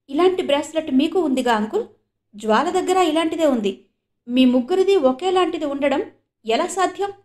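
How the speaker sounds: astonished question climbing high and loud; amazed, can't believe the bracelets match. A narrator's reading. Krish asks the uncle about matching bracelets, astonished. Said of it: "అడిగాడు క్రిష్ ఆశ్చర్యంగా".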